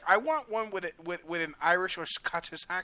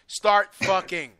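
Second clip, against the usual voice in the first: loud, drawn-out mock order; emphatic, stretched-out joke order; mock command; 3 semitones higher, 7 dB louder, much slower than usual. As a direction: bellow each word slowly, as a joking command. Mock order to have more children.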